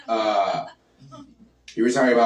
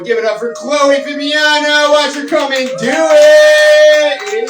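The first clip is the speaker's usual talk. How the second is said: hype announcer shout; 10 dB louder, slower than usual. Real, not acted. Host announcing next comic.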